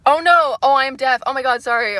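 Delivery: loud sudden oh no; startled loud realization; embarrassed realization. Real, not acted. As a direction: blurt it out in shock. Realizing own mistake on stream.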